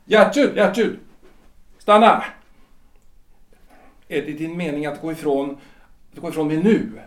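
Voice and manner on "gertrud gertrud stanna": loud, raised calls, then a sharp stop; calling her back. Acted. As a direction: call after her to make her stay. Husband calls wife back as she leaves.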